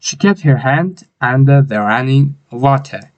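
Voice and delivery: very slow, clear model read; slow model sentence. Shown as a demonstration. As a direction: read it slowly for the class to copy. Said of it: "model reading of sentence".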